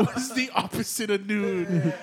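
cracking up, slow words squeezed through laughs; laughing. Real, not acted. Cracking up at midnight mix-up.

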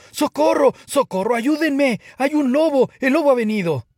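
high, loud screams for help; frantic shouting. A narrator's reading. Terrified shepherd boy seeing a real wolf, shouting frantically. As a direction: yell like mad in terror. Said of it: "comenzó a gritar como loco".

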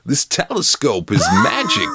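high, hammed-up wonder; feigned amazement. Hero pretends to marvel at telescope.

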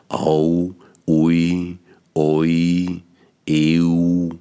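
"Au", "ui", "oi", "eu" each glided slowly from first vowel to second; diphthong demo. Shown as a demonstration. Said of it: "Ein Diphthong ist ein Doppellaut".

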